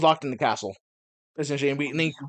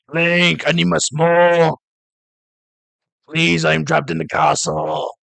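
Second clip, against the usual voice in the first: louder raspy chain-smoker princess; smoker's rasp; 5 dB louder than usual. Retold or imitated. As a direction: plead like a chain-smoking princess. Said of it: "me imitating ... doing a Smoker voice".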